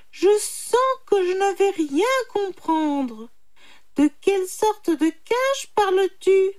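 high put-on animal voice; confused little jackal. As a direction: play dim and whiny, pitched high. Jackal feigning stupidity. (petit chacal embrouillé, voix aiguë)

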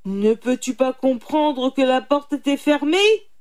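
loud, exasperated, rising yell; yelling tiger. A narrator's reading. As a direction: shout it in fury, pitch climbing. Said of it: "hurla le tigre". (tigre qui hurle, exaspéré)

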